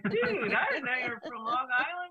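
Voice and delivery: mock amazement. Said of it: "I was like".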